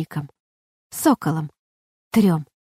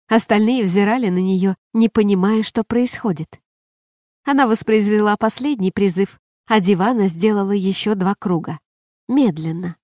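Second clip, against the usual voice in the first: plain reading voice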